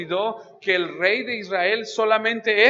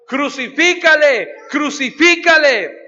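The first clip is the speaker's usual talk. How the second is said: loud, higher and slow, each word hammered; crowd's demand; 6 semitones higher, 7 dB louder, slower than usual. Acted. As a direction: call for it like an angry mob.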